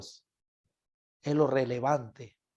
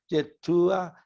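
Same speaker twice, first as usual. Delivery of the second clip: ordinary talk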